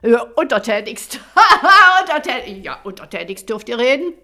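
loud scornful mimicry with laughter; mock the servile word; mocking warlord. Warlord mocks the apprentice.

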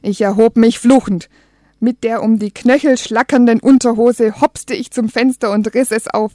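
ordinary delivery

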